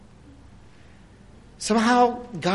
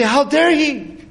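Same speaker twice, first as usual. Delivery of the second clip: raised, higher-pitched affronted challenge; mock indignation; 6 semitones higher, 4 dB louder, slower than usual. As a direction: act out people taking offense. Quotes people offended by the interruption.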